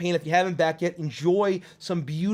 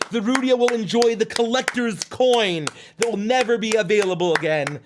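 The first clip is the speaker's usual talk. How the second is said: high, loud cartoon showman; Chuckles, thrilled; 7 semitones higher, 5 dB louder than usual. Host celebrating an all-in backer in the chat, thrilled Chuckles voice. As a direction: crow the news in a zany, excited character voice.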